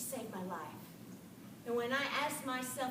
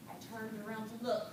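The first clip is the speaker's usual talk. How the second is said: ordinary delivery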